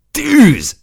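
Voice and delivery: enraged battle cry; furious full-throat yell. Acted. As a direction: scream the fire order at full voice. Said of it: "üvöltöttem teljes torokból és dühből".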